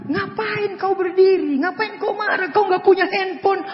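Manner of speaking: a hint of a wife holding him back; wife scolding. Wife stopping her angry husband, lightly voiced teasing. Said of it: "istriku tahan".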